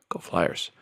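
breathy whispered fan cheer. Real, not acted. Hoarse fan cheering his team.

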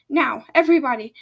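loud, quick rallying call; shouted. A narrator's reading. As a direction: call the whole group to action. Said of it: "Spew shouted".

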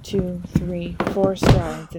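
ordinary delivery

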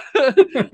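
sudden high, loud laugh; incredulous laugh; loud burst of laughter. Real, not acted. Laughing at absurd future tech.

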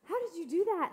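put-on high, loud amazed child's exclamation; kids amazed. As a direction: gasp it out like a wowed kid. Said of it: "my kids were like".

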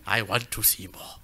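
put-on slow, low Dracula accent with a laugh; half-laughing mock Dracula accent. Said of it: "almost go into a Dracula accent".

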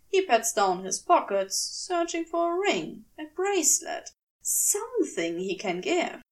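ordinary delivery, nothing marked

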